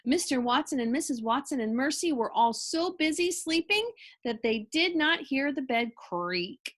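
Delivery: ordinary delivery, nothing marked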